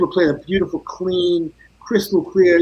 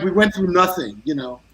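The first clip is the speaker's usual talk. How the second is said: ordinary talk, nothing marked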